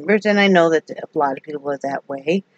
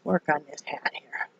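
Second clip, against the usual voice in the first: whispering; 2 semitones lower, 14 dB quieter than usual. Real, not acted. Quiet aside while crafting.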